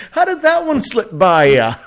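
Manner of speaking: high, laugh-choked mocking question; incredulous laughing. Real, not acted. Mocking a woman's birthday excuse, laughing incredulously. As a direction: squeeze the question out through laughter.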